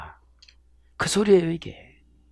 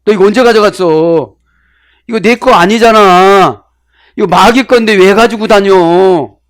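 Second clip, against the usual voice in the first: louder, higher accusing questions; scolding rebuke; 5 semitones higher, 7 dB louder than usual. Acted. Preacher scolds a believer for taking what's not theirs, loud and accusing. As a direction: catch the thief out and scold him.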